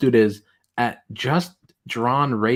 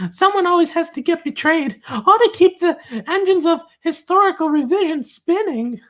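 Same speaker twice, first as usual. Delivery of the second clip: mocking tweet voice. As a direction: read it out like a squeaky, solemn critic. Continues reading tweet in mocking voice.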